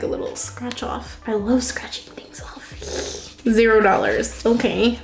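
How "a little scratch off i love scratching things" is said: soft unvoiced whisper to herself. Real, not acted. Vlogger whispering while scratching a card. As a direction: breathe it out, secretly delighted.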